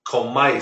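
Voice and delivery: comais stressed on the last syllable, co-MAIS; accent-mark stress. Shown as a demonstration. Teacher showing the accent trumps the rule, comais end-stressed. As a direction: land firmly on the ending of comais. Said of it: "accent mark trumps the rule".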